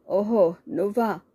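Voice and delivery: slow, sneering oho in another voice; scornful slow jeer; mocking. A narrator's reading. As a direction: drawl it out to belittle her. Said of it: "హేళనగా అన్నాడు ప్రమోద్ కుమార్".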